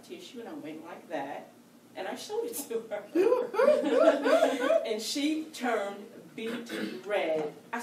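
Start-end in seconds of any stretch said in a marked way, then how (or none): 3.1-4.8 high laugh. Real, not acted. Laughs at the tissue moment.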